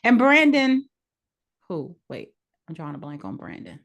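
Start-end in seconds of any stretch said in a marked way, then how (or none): none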